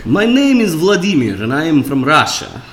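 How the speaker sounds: put-on Russian accent in English; mock Russian accent, opening on a swooping rise; Vladimir. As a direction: introduce yourself as a Russian, in a thick accent. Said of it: "I have to s-, do Russian".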